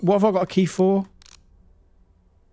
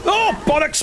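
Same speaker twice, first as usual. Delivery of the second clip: ordinary talk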